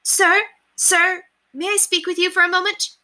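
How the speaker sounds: very high, loud urgent call; calling out to guard. Acted. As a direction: get the guard's attention.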